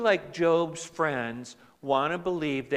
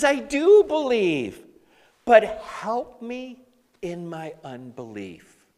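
desperate plea; 5 semitones higher, 8 dB louder than usual. Acted. Father cries out convicted.

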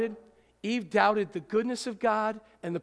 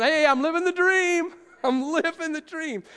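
higher and louder, hammed-up chirpy "I'm living the dream"; mock cheerful catchphrase; 8 semitones higher, 6 dB louder than usual. Preacher mocking people who say they're living the dream, hammed-up cheer. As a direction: sing out "I'm living the dream" like an overeager greeter. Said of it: "every day going".

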